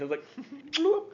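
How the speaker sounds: high cartoon bloop with a laugh; playful bloop noise; plop sound. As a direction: imitate a wet plop.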